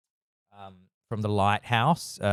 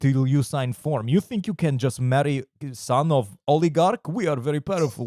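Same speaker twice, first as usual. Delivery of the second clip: put-on pushy Russian accent, pitched up and insistent; Russian gangster; 4 semitones higher than usual. Gangsters demand a signature on the annulment, insistent Russian accent. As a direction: imitate a Russian heavy making demands. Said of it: "gangsters that represent him".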